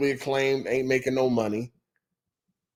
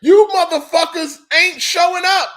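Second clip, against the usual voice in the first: much higher, louder, exasperated rant that slows down; mock outburst; 12 semitones higher, 7 dB louder than usual. Game studios blaming absent buyers, hammed-up angry yell. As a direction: play a fed-up developer yelling at the players. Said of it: "They're like".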